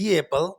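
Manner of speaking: "the apple" with a slight y sound, "dee-yapple"; y-glide "the apple". Shown as a demonstration. Teacher models the y glide between the words. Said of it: "ی جو ایک آواز آ رہی ہے".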